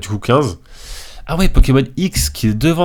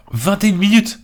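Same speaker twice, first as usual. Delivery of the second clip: much higher, startled exclamation; surprised; 11 semitones higher than usual. Real, not acted. Gamer discovering the video's running time, surprised. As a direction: blurt it out high in surprise.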